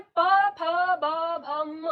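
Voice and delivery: "प, फ, ब, भ, म" popped from the lips, loud at first, then fading; reciting consonants. Shown as a demonstration. Teacher to class: lip consonants, loud and clear. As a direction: burst "प, फ, ब, भ, म" off the lips, loudly. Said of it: "तो हम बोलते हैं".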